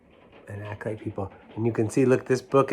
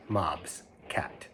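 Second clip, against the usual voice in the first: hushed, breathless close; whisper; 5 semitones lower, 13 dB quieter than usual. Acted. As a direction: whisper the end of the rhyme. Whispered ending of rhyme.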